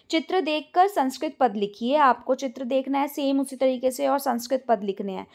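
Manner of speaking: usual speaking voice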